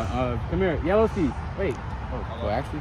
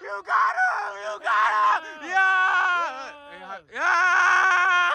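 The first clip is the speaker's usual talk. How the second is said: excited shouting; 4 dB louder, much slower than usual. Real, not acted. Cheering a contestant getting out.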